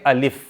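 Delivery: अलिफ said cleanly as a letter name, a short a then lif; letter name alif. Shown as a demonstration. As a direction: model अलिफ for the class. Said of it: "तो कैसे पढ़ेंगे?".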